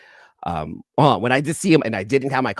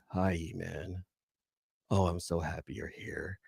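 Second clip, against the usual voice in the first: imagined sweet reply; 5 semitones lower, 12 dB quieter than usual. Retold or imitated. Imagined artist greeting her fan in a softer, lower voice. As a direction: play the artist answering warmly, hushed and low. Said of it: "That's how she answered in my head".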